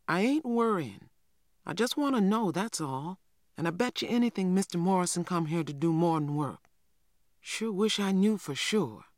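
put-on a curious child pushing her brother for answers; eager young girl. Young girl Cassie voiced.

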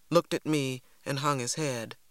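usual speaking voice

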